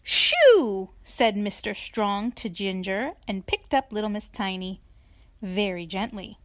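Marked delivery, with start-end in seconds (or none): 0.0-0.9 loud, higher, sharp shoo; shooing shout. A narrator's reading. Mister Strong to the farm cat, shooing shout. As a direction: shoo away an animal.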